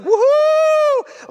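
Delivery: high, long held "woohoo"; gleeful whoop. Acted. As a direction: whoop as if riding down a slide. Imitates sliding down the rubble.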